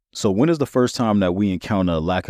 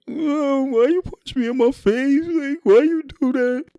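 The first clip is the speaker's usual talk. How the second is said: put-on very high, wailing kid voice; punched little brother sobbing to his brother, hammed up; crying; slower than usual. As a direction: whine like a little boy in tears. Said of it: "So Drew starts crying".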